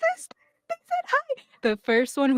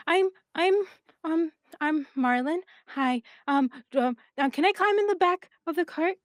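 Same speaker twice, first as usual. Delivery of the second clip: put-on higher shy child voice; a little kid asking to ride; hesitant childlike voice; kid Marlin; 6 semitones higher than usual. GM plays Marlin.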